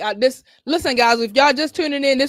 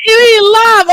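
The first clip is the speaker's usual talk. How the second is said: excited shout; 12 semitones higher, 10 dB louder than usual. Real, not acted. Celebrating audio working.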